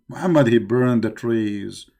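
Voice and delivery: ordinary talk, nothing marked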